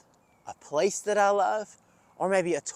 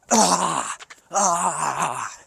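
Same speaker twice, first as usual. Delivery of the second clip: frustrated groan. Acted. Coach groans at his missed basketball shot, frustrated.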